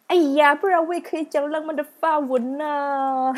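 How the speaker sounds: high, loud playful whine; mock lament. Joking to listeners about the lost French class, mock lament. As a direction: wail in mock regret, drawing out the end.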